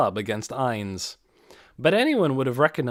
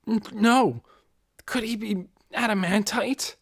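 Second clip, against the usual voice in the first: astonished disbelief; 9 semitones higher than usual. Acted. Astonished onlooker at Ainz's strength.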